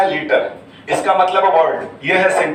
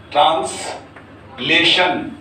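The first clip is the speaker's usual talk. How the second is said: syllable by syllable; much slower than usual. Shown as a demonstration. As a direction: stretch it out for a class, pausing mid-word. Teacher breaks up new term.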